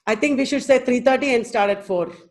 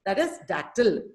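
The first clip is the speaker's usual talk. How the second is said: ordinary talk, nothing marked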